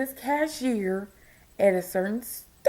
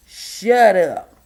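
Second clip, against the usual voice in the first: loud; 4 semitones lower, 9 dB louder than usual. Real, not acted. Ranting at unwanted commenters.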